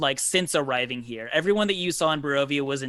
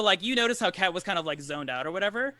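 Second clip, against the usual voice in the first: ordinary talk, nothing marked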